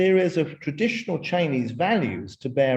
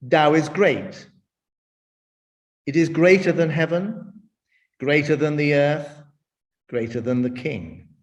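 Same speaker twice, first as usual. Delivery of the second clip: louder reverent reading with long pauses; scripture reading; 3 dB louder than usual. Shown as a demonstration. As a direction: read it like sacred text with weight. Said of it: "I'm just going to read from the Tao Te Ching".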